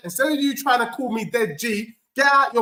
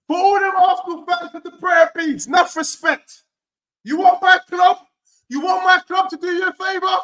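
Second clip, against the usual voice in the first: taunting shout; 7 semitones higher than usual. Real, not acted. Taunting rival fans, shouting.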